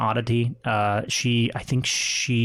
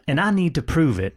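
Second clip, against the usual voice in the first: louder, higher Southern voice; southern accent; 5 semitones higher, 3 dB louder than usual. Acted. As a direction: finish the plea in a Southern accent. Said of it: "still doing my, uh, my southern accent".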